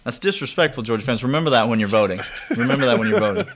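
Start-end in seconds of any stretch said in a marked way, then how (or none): 2.5-3.5 a laughing, higher second take; mock warning to voters, laughing; laughing higher repeat. Shown as a demonstration. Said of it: "same line said twice".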